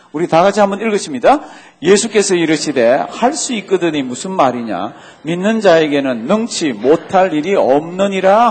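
loud, steady unison reading; group scripture reading. Real, not acted. Pastor leading the congregation, loud unison reading. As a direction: read it aloud together with the church.

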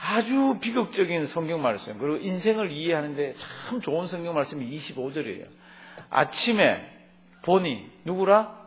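ordinary talk

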